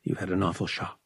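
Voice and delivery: calm her down quietly; soothing. Acted. Vic steadying trembling Bria, soft and soothing.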